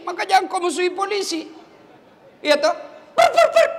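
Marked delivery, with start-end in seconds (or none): 3.1-3.7 high, piercing imitation of a traffic whistle; shrill pur pur pur of a traffic cop; mock police whistle. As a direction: blow the whistle with your voice.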